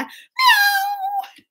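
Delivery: one long, very high meow; loud cat meow. Pretend. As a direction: yowl to be the loudest cat. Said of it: "Who's the loudest cat there?".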